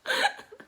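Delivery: high, loud burst of laughter; laughing fit. Real, not acted. Storyteller breaking up before showing what he did, helpless laughter. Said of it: "Stop laughing".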